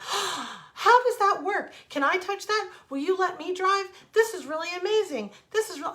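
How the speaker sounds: imitating a thrilled, amazed little boy; high, loud rush of wonder after a gasp; suddenly chatty child. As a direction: gush questions like an excited child.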